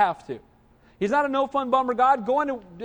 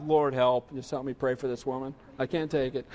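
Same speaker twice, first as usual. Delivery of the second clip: much lower and softer, a quick pleading prayer; desperate prayer under breath; 9 semitones lower, 5 dB quieter than usual. Retold or imitated. Frustrated in line, praying softly under his breath. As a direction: mutter a frantic plea to God. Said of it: "I'm like".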